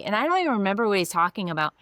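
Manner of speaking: ordinary talk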